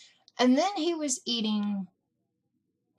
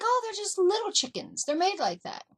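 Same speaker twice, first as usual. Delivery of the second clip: higher, airy brush-off; a light imitation of a breezy mom, higher; mock breezy mom; 11 semitones higher than usual. Mom voiced dismissing question.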